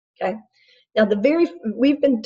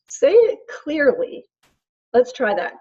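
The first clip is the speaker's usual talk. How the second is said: high, slow and deliberate; patient coaching; 5 semitones higher, slower than usual. Retold or imitated. Parent asks whining kid to speak up, patient coaching. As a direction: spell it out slowly for a small child.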